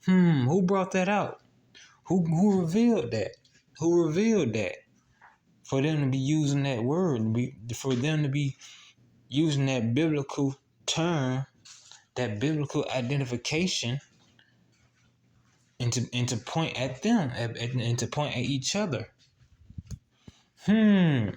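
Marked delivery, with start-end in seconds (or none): 0.0-4.8 louder and higher, pressing the question; raised preacher's voice; preaching emphasis. Real, not acted. 20.6-21.3 loud, high knowing hum; a hum that says think about it; loud preacher's hum; emphatic hum. Real, not acted.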